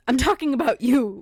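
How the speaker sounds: mock loud accusation. Prophet confronting the king in jest, mock loud accusation. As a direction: play-act calling him out loudly. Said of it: "Then Nathan said to David".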